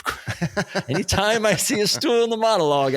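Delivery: laughing while saying it, rising pitch; amused dread through a laugh. Real, not acted.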